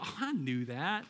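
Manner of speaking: low, slow, underwhelmed mock reply; unimpressed listener. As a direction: imitate a listener who already knew it. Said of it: "you're gonna listen to this and go".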